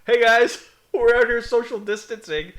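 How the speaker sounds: loud, bright hammed-up street reporter greeting; mock reporter call. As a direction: shout it like a goofy TV host on the street.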